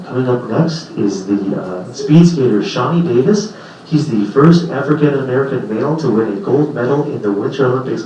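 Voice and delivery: mock sports commentary; announcer. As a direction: introduce the skater like TV.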